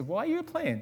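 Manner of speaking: high put-on voice; high curious question. Stranger questioning the plane, high voice.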